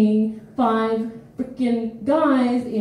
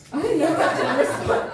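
dopey put-on voice, laughing through it; mock flustered catcaller; 2 semitones higher than usual. Stumped catcaller after being confronted, laughing mock dopey voice. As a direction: play the catcaller stumped for words, half laughing. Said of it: "And they're like".

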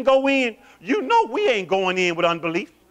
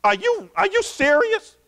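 incredulous; 10 semitones higher than usual. Acted. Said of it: "he's saying".

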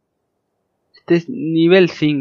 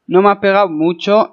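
ordinary delivery

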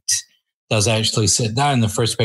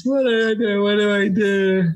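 high, laughing, comic fretting; mock panic; 10 semitones higher than usual. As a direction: play at being lost and overwhelmed, laughing. Acts out his panic before sitters.

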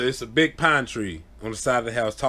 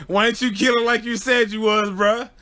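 teasing; 10 semitones higher, 4 dB louder than usual. Retold or imitated. Teasing scared cousin.

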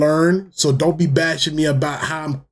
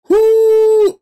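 high, loud, sustained "whoo"; big whoo; 6 dB louder than usual. Real, not acted. Fan winding down after a wild finish, high loud whoo. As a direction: let out a long high hoot. Letting out tension after game.

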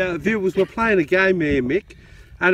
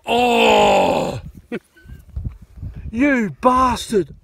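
loud, higher oh, then a slow, drawn-out you bastard; playfully outraged amazement; amazed shout; 4 semitones higher, 3 dB louder, much slower than usual. Real, not acted. Seeing the eagle on the coin, shouting slowly and playfully outraged. Mate shows the American eagle.

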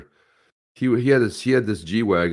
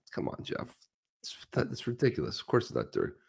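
hushed, much softer aside; retold dismissal; 5 semitones higher, 11 dB quieter than usual. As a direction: brush off a friend under your breath. Retold dismissal of friend's claim.

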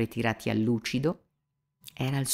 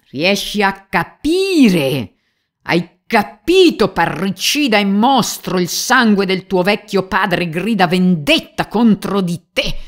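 much higher and louder, slow, raging; furious shout; 9 semitones higher, 11 dB louder, slower than usual. Acted. Police chief to Mitya, a furious accusing shout. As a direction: bellow it, shaking with rage. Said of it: "gridò improvvisamente il capo della polizia ... fuori di sé dalla rabbia".

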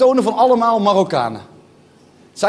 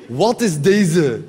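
lower, very slow "Wat is deze" in street slang; 5 semitones lower, much slower than usual. Retold or imitated. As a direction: drop low and stretch "Wat is deze". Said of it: "Dat vind ik zo'n leuke zin".

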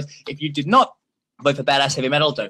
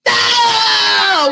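rising louder and higher to a shouted finish; excited countdown; 6 dB louder, much slower than usual. Real, not acted. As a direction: count down like a game show host. Countdown to vote close.

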